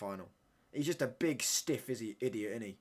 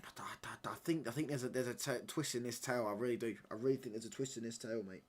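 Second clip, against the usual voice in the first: hushed whisper; 8 dB quieter than usual. Real, not acted. Football fan watchalong whispering a hunch to viewers. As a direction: drop to a secretive whisper.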